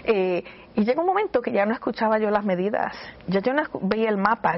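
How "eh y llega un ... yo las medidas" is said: ordinary talk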